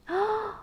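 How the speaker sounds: sharp excited gasp. Acted. Reader lifting the flap for the child, gasping. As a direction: gasp as if peeking under the flap.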